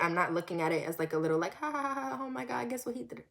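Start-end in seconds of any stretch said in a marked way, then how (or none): 1.5-3.2 mock giggly gossip. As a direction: imitate someone lightly laughing it off. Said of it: "so I'm not joking about it".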